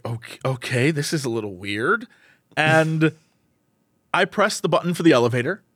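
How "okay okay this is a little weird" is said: low, subdued unease; wary aside. Retold or imitated. Narrator to himself on seeing the bobbing man, wary. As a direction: think it aloud, quietly uneasy.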